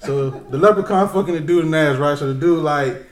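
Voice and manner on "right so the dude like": ordinary delivery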